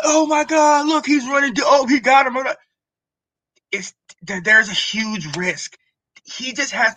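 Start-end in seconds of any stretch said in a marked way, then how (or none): 0.0-2.4 high, amazed onlooker; mock excited fan. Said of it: "everybody might be like".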